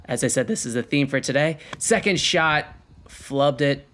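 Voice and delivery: usual speaking voice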